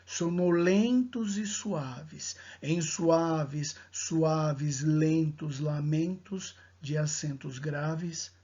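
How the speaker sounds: slow, lilting poem recital; poem reading. Acted. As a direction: read it like a verse. Reads poem aloud.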